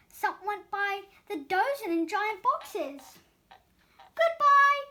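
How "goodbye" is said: high, loud farewell call; cheerful yell. Acted. Donut Arnie yells goodbye to departing donuts. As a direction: shout goodbye to the leaving donuts. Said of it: "Arnie yelled to each donut".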